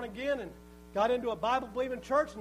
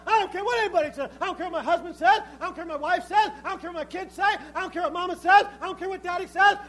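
loud, higher-pitched preacher's resolve; emphatic, repetitive defiance; defiant declaration; 5 semitones higher, 6 dB louder than usual. Acted. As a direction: rattle off each refusal with insistent force. Acts a believer's defiant declaration.

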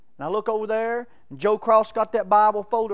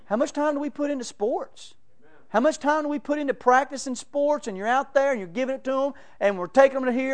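higher, driving sermon build; fired-up preaching; 5 semitones higher, 4 dB louder than usual. Acted. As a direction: press the congregation hard. Preaching at full force.

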